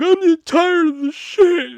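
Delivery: loud, high, drawn-out mock wail; hammed-up tearful outburst; imitated crying. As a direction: wail it out like an upset coworker.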